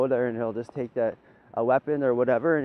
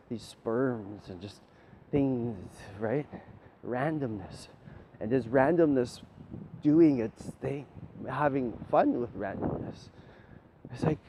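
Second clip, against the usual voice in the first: ordinary talk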